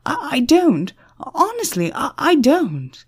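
high-pitched, halting stammer; anxious stammered denial. A narrator's reading. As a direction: stumble over the words in a high, pleading voice. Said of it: "Christopher stammered".

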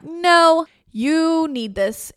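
loud, high "No", then a very slow "you need this"; firm friend who won't leave; insistent friend. Retold or imitated. Best friends at the door insisting, loud and slow.

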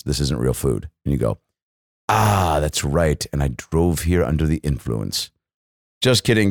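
ordinary talk, nothing marked

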